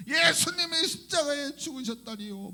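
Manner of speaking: high, tearful cries swelling into a shout; imitated wailing. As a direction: act out a convert wailing to Jesus, voice pitched high. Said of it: "막 이런 통곡".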